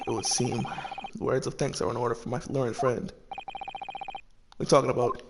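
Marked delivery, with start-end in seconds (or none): 0.1-3.1 lightly voiced grave barrister, low and soft; hushed, formal courtroom rival. Voices a courtroom game character.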